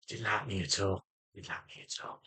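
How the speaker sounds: very soft, sinking into a whisper; hushed aside. Real, not acted. Vlogger on the doctor who disliked him, hushed aside. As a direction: mutter it low, almost to yourself. Muttered aside after retelling the exchange.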